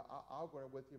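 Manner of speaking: normal talking voice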